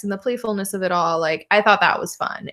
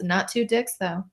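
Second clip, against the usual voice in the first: normal talking voice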